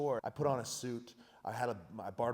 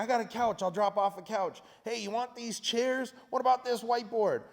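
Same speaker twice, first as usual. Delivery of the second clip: higher, bright eager volunteers chiming in; imitating excited helpers; eager offers; 9 semitones higher, 4 dB louder than usual. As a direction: pile on generous offers one after another. People eagerly offering furniture.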